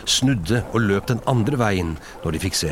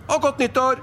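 very high, cheerful parting call. Acted. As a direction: call back merrily on the way out. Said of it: "ropte Fred". (lyst, muntert rop)

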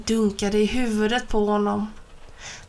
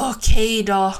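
growled; 7 dB louder than usual. A narrator's reading. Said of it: "morrade Ben".

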